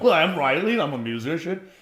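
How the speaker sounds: high, loud, put-on friendly intro; fake introduction. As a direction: sell yourself falsely to the camera. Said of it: "I'm just being fake as hell".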